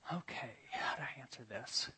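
soft unvoiced thinking aloud; whispered inner thought. Preacher wondering how to answer the student, whispered. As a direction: whisper it to yourself, unsure.

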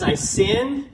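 high, drawn-out acted plea; imitated desperate confession; begging for mercy. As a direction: plead with rising pitch, unsure of pardon. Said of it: "He starts begging. He cries out for mercy".

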